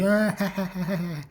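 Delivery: high "ah" falling into ha-ha-ha; tongue-out mock laugh. Mimicking one laughing guy, high tongue-out cackle. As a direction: imitate a laugh with tongue stuck out. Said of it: "even sticking his tongue out".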